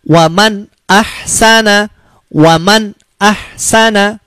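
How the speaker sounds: waman ahsana with the n said clear, no nasal hum; slow and clear: waman ahsana, nun crisp before the hamzah; clear short izhar. Shown as a demonstration. Quran teacher showing students the correct izhar reading, slow and clear. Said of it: "Bacanya begini".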